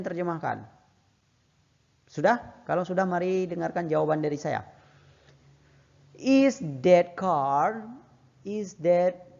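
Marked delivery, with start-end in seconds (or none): 6.2-9.3 very slow, stretched words for learners; slow teacher demo; very slow model reading. Shown as a demonstration. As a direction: drag each word out so students can follow. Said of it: "teacher models English answer slowly".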